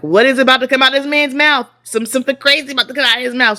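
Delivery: high, loud alarmed thought; mock panic. Wife bracing for husband's comment in public, mock panicked. Dreads what husband will say.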